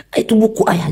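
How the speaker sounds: memerankan ayah yang kesal, nada tinggi; ayah marah. Retold or imitated. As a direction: bentakan tiruan, nada naik tinggi. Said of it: "Jangan langsung marah". (meniru ayah yang membentak anak)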